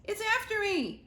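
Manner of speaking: panicked. Acted. Squirrel telling the chicks, panicked and out of breath. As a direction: say it like something is chasing you. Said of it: "out of breath and in a panic".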